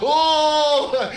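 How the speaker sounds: sudden high yelp; mock shocked oh; gasp of realization. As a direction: blurt it out startled. Tripping, shooting through the roof.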